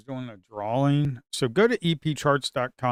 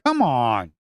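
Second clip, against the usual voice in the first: loud frustrated outburst; 9 dB louder than usual. Real, not acted. Trader shouting at a losing trade, frustrated. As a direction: snap it out at the market, fed up. Trade going against him.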